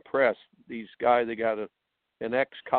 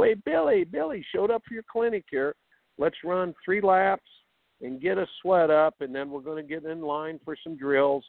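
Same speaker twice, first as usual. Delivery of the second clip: higher, chipper club pro voice; country club coach; 4 semitones higher than usual. Retold or imitated. Tennis coach mocking country club coaching, chipper and high. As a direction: sell it like a breezy club pro. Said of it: "country club coaching where you go".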